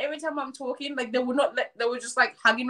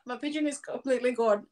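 usual speaking voice

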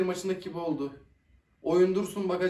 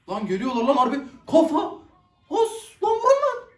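shouting much higher and louder, rising to a scream; excited shouting; 9 semitones higher, 7 dB louder than usual. Real, not acted. Fan screaming at players to shoot, excited. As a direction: cheer the attackers on at full voice. Fan urging attack on screen.

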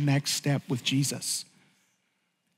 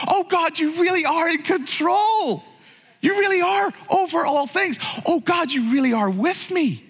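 awed outcry; 10 semitones higher than usual. Acted. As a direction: cry it out to God in amazement. Sudden realization cried out to God.

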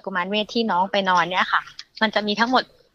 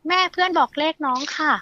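put-on higher, childlike voice for the two-year-old's words; mother voicing her small daughter, higher and childlike; imitating her toddler; 6 semitones higher than usual. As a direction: mimic a little kid telling mom a number. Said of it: "เขาจะบอกว่า".

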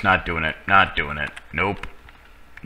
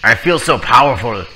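megalomaniac rant; 3 semitones higher, 6 dB louder than usual. Pretend. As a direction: boast like a power-mad villain. Holding a gun, grandiose.

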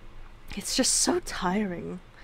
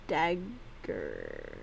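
spooky voice; 13 semitones lower, 11 dB quieter than usual. Pretend. Drops into a deep spooky voice.

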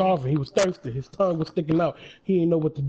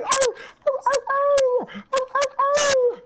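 high whimpering ows, half laughing; mock crying dog; slower than usual. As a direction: play a hurt dog yelping in pain, hammed up. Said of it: "He's crying".

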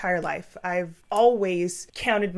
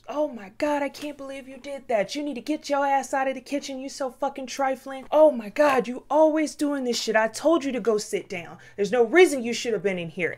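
higher, scolding mock yell; retold parents yelling; 7 semitones higher than usual. Parents scolding child out of the kitchen, imitated angry yell. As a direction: berate the clumsy kid, half-hearted yelling. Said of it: "like, yelling".

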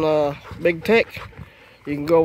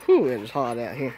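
ordinary talk

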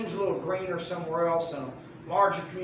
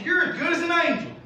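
higher and louder, flattering praise; gushing king; 10 semitones higher, 6 dB louder, faster than usual. Acted. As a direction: lay the praise on thick. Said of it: "He says".

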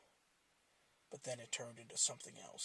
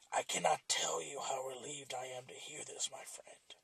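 a sword whispering its darkest secret; whispering machete. Acted. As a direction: breathe the confession out like a remorseful blade. Machete speaks again.

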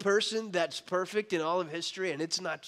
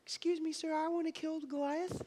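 put-on boy's voice; 7 semitones higher, 8 dB quieter than usual. Boy David to King Saul.

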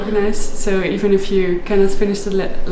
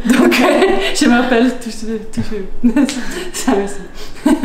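laughing; 3 semitones higher, slower than usual. Real, not acted. Laughing at a hard climb.

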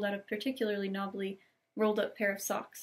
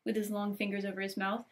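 ordinary delivery

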